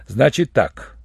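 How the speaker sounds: ordinary delivery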